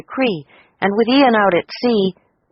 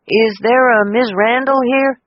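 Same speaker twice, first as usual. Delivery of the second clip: lightly voiced delivery man; a hint of a brisk courier; 5 semitones higher, 3 dB louder than usual. A narrator's reading. Delivery man asks for Cecilia, lightly voiced.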